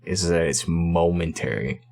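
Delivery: ordinary talk, nothing marked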